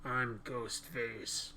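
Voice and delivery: hushed mock killer; Ghostface impression.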